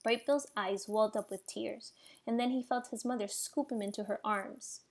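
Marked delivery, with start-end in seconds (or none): none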